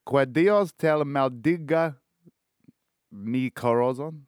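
slow, careful Spanish accent on the title; put-on Spanish accent. Shown as a demonstration. Podcaster reading a Spanish album title in Spanish accent. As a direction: lean into a Spanish accent, slowly. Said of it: "you gotta put the fucking accent on".

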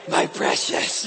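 rasping, voiceless creature hiss; whispery, rasped creature voice; Gollum-style creature. Acted. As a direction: croak it like a creature hoarding treasure. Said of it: "type thing, you know".

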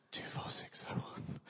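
a secret number, whispered; whispered prison number; hushed whisper. Pretend. Reciting Valjean's prison number.

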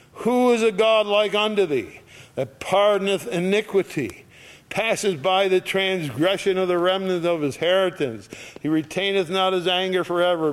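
high, loud proclaiming of the verse; preaching scripture. Acted. Preacher reading Micah to the congregation, loud and high.

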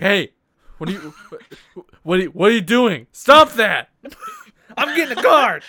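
loud, high angry yell; mock yelling chef. A chef yelling at a man standing still, mock shouting. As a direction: bark at the idle guy like a furious chef. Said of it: "He's like".

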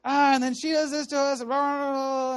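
high mock-angry rant; retold yelling. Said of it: "I just started yelling at him".

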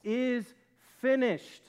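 high, forceful triumphant declaration; loud cry. Retold or imitated. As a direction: cry it out loudly, word by word. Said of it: "He cried out loudly".

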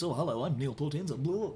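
lightly put-on Australian voiceover-guy voice; Neil Portenza voice. Shown as a demonstration. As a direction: ease into a showman character voice, lightly. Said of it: "Neil's voice was very much like this".

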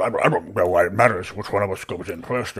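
rapid, grumbling complaint; bickering. Acted. Barry arguing from outside, mildly bickering. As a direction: bicker back and forth in character. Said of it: "you kind of hear some just bickering".

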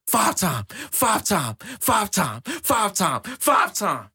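high, chanted wrestler's boast; Booker T. Retold or imitated. Host imitates champion counting titles, Booker T impression. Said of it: "What Booker T say?".

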